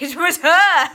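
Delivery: loud, high imitated yell; mock accusing shout. Co-host imagines her friend grassing in a mock yell. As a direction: shout out your friend, half joking. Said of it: "you'd be like".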